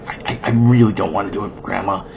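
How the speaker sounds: low, soft, hesitant protest; reluctant grandson. Acted. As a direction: mumble an apologetic refusal. Reluctant boy refusing grandma.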